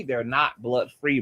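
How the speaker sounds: ordinary delivery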